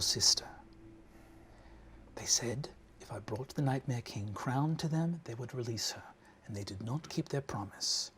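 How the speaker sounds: a scheming mother speaking in a breathy whisper; mother's hushed voice. Acted. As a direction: whisper it like a cold, controlling matriarch.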